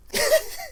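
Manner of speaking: very high, gleeful squeal of laughter; triumphant cackle. Real, not acted. Gloating that Mary Ann drank it all, high cackle. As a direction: squeal with nasty delight.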